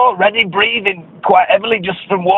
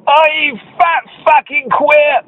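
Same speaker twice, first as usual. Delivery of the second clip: retold jeer; 7 semitones higher than usual. Attackers' shout retold.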